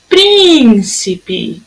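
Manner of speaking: "Príncipe" with a long, held "Prín"; stretched first syllable. Shown as a demonstration. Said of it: "a sílaba tônica é a proparoxítona".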